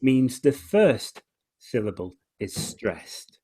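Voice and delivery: ordinary delivery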